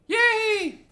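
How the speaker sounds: very high put-on girly cheer; delighted girl character. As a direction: squeal it happily in a girl's voice.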